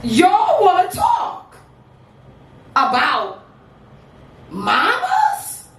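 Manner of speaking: punchy performance poetry with rising set-ups and long pauses; spoken-word poem. Acted. Poet boasting to an audience about mama, proud spoken-word delivery. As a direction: proclaim each line, then land the refrain.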